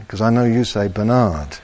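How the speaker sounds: ordinary talk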